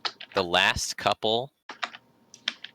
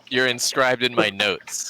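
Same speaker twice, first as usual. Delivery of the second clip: laughing; 5 semitones higher, 4 dB louder, slower than usual. Real, not acted. Laughing reassurance.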